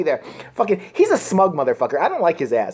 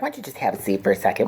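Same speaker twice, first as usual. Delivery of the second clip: smug host impression; 10 semitones lower, 5 dB quieter, faster than usual. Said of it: "comes out there and be like".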